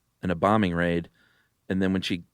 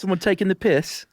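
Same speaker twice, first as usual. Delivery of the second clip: mock British accent; 12 semitones higher than usual. Answers with British slang quip.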